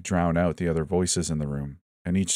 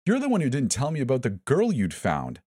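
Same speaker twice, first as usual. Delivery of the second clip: high, pointed accusation; counter-accusing; 7 semitones higher than usual. A narrator's reading. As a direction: throw the blame back.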